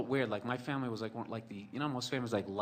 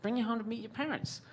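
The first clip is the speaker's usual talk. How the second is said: put-on high, whiny girlfriend voice; squeaky nagging girlfriend; high plaintive girl voice; mimicking girlfriend; 12 semitones higher than usual. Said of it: "So she's like".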